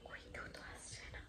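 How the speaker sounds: frightened hushed whisper; scared whisper. Real, not acted. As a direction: whisper tensely, afraid of discovery. Hiding in fear, says scared.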